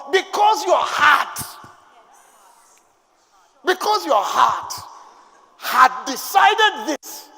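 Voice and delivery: slow, loud, drawn-out divine pronouncement; solemn booming voice of God. Acted. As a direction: speak as God, heavy and slow. Voicing God to Solomon.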